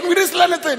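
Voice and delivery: high, emphatic denial; loud protest. Acted. Accused brothers answering the governor's men, loud protest.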